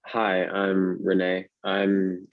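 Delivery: normal talking voice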